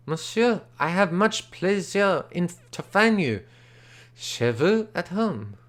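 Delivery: put-on a Frenchman bursting in with French-accented words. Frenchman greets auctioneer.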